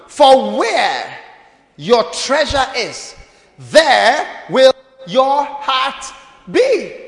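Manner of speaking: slow, loud, stretched Bible line; drawn-out verse. Acted. Pastor lands the key verse, very slow and loud. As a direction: stretch every word like a proclamation.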